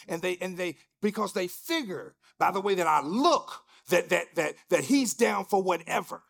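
loud, emphatic preaching. Real, not acted. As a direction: call them out from the pulpit. Sermon at full force.

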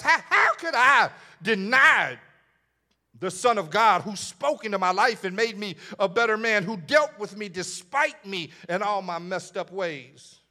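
loud, high preacher's cry; preacher crying out Peter's grief; preaching at full force. Acted. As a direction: shout Peter's anguish to the church.

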